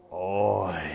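slow guttural "Oi" from the throat; guttural bemoaning oi. Shown as a demonstration. Preacher leading the congregation, guttural moaning oi. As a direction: moan "Oi" like deep sorrow. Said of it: "as guttural and slow and bemoaning as you can".

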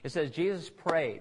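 ordinary talk

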